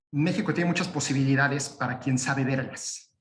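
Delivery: usual speaking voice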